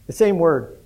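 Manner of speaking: ordinary talk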